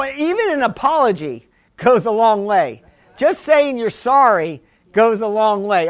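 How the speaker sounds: ordinary talk, nothing marked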